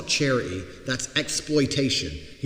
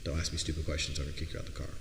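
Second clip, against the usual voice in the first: lower, softer, unbothered; cool gangster; 8 semitones lower, 7 dB quieter, faster than usual. Retold or imitated. Telling the girl off from the driver's seat, low and cool. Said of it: "I was like".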